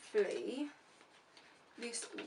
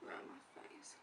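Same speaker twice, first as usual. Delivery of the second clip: whisper; 3 semitones higher, 14 dB quieter than usual. Real, not acted. Absorbed in applying makeup.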